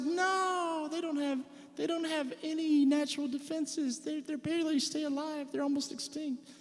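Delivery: imitating wife. Husband telling the audience how his wife answered, high imitation. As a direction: put on her high, matter-of-fact tone. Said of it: "And she's like".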